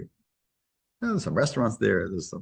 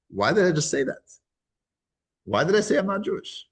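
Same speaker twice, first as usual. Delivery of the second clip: higher-pitched puzzled question to oneself; baffled self-questioning; 8 semitones higher than usual. Retold or imitated. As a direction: question yourself, pitch climbing. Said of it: "he was thinking to himself".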